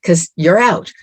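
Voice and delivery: ordinary delivery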